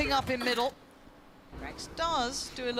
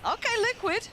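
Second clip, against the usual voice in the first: excited; 10 semitones higher than usual. Real, not acted. Caster reacts to a won fight.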